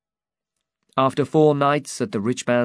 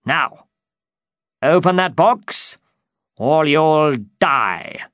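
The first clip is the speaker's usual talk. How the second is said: threatening command; 6 semitones higher, 7 dB louder, slower than usual. Acted.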